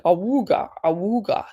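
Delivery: mimicking an old car horn, awooga; alarm horn noise. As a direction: honk it out like a klaxon. Alarm call as a joke.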